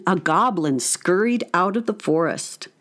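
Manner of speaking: usual speaking voice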